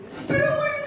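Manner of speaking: high squealed name, starstruck; excited squeal. Retold or imitated. Fan squealing at his idol Stan Lee, high and excited.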